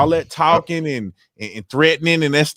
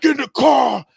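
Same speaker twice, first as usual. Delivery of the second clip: father's sharp, much higher command; angry father; 11 semitones higher than usual. Retold or imitated. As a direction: bark it like a furious dad. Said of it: "he was hot".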